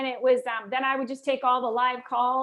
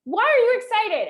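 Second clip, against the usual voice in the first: barking at her, higher and louder; mock bark; 9 semitones higher, 8 dB louder than usual. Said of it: "And he was like".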